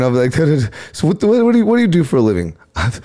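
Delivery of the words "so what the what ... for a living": mock stutter. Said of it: "now I got 'em stuttering".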